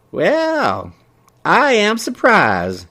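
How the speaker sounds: put-on high, slow delighted old lady; drawn-out happy surprise; pleased surprise. Mabel pleased.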